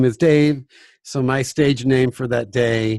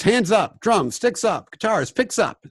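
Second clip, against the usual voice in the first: call to order; 8 semitones higher than usual. Retold or imitated. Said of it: "one of my teachers said that".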